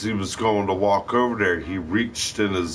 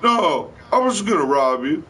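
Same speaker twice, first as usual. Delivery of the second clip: much higher, stammering, terrified begging; panicked pleading; 12 semitones higher than usual. Retold or imitated. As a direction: stutter and plead like a gun is on you. Robber begging not to be shot.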